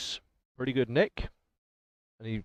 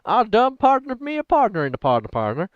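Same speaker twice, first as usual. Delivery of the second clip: hammed-up Southern cowboy; Southern cowboy accent; 8 semitones higher, 4 dB louder than usual. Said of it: "hey, partner".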